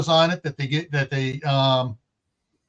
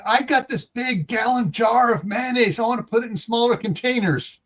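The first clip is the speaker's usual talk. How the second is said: higher-pitched voice of a woman asking; lightly voiced questioner, pitched up; quoted mayonnaise owner; 6 semitones higher than usual. Retelling a woman's mayonnaise question, lightly voiced in higher pitch.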